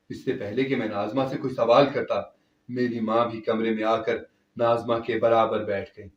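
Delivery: usual speaking voice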